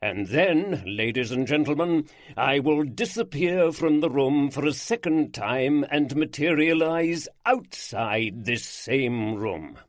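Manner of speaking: bolder, every phrase emphasized. Acted. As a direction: hammer home each phrase like a ringmaster. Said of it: "his voice was bolder, emphasizing each phrase".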